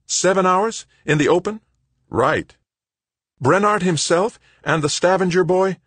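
high, loud urgent questions; urgent, demanding. Acted. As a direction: press hard for answers. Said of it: "urgent, demanding".

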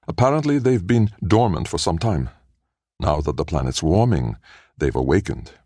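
usual speaking voice

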